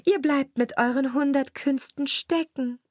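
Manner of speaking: high cat voice calling down; high, carrying taunt. A narrator's reading. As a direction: call it down pitched high, as the cat. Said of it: "rief die Katze".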